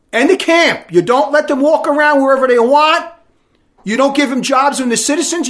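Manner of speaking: high, loud angry repeat; angry shout. Real, not acted. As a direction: hammer it home, shouting.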